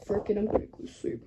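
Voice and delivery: slurred, fading to a hushed mumble; sleepy drunk giving up; mumbling off to sleep. Retold or imitated. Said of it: "He literally just goes drunk".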